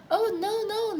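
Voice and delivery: high, amused protest; a light laughing no; laughing twin. A narrator's reading. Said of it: "laughed Little Miss Twin".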